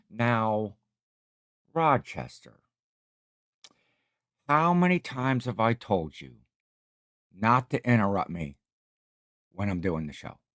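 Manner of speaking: a light hint of Jack Benny's mock-exasperated scolding; Jack Benny impression. Streamer to chat, a light Jack Benny bit, mildly exasperated. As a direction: gently scold Rochester as Jack Benny would. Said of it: "That's my Jack Benny".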